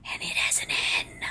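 and it has an N whispered; stressed whisper. Real, not acted. Vlogger hisses out that the word has an N. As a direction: whisper the N, pointed. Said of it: "The word is written".

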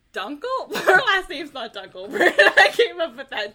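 laughing through the words; giggling at her own mistake. Real, not acted. Laughing at own name slip.

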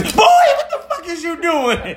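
very high, drawn-out mock shriek; imitating startled scream. Imitating partner scared at the refrigerator, high startled shriek. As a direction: squeal it slowly like someone who got jumped. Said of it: "Not just a scream".

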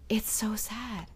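whispered, quiet sorrow; a hushed, saddened echo; soft sad whisper. Real, not acted. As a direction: breathe it out softly in sympathy. Echoing sad story softly.

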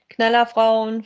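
ordinary talk, nothing marked

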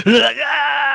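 high sudden attack cry; monster yell. Retold or imitated. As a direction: jump out with a beastly yell. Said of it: "Josh just comes up to me like".

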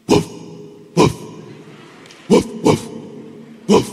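short mock woofs; clipped imitated barking; dog barks. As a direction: bark like a dog in the night.

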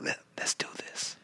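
breathy whisper, barely voiced. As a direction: whisper it like a secret invitation. Acting out a partner's line.

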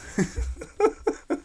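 high airy laugh cracking the impression; breaking into laughter. Real, not acted. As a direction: burst out laughing mid-impression. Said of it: "that's my Obama impression".

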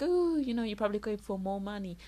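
high mocking "Oh"; sing-song jab at a doubter; imitating a snide acquaintance; mocking other people. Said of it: "they'll be like".

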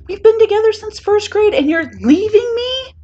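high, loud wail of betrayal. A narrator's reading. To a departing friend since first grade, wailing. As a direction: wail in melodramatic protest. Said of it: "Kaminari wails".

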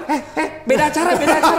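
fast, high urgent warning; hurried producer warning. Retold or imitated. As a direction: rush it like a panicked producer. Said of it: "Produser, produser bilang".

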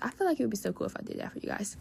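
fast, soft whispered aside; hushed quick aside while giving advice. Real, not acted. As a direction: whisper it quickly as a private thought.

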